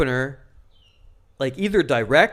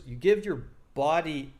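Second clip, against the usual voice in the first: ordinary delivery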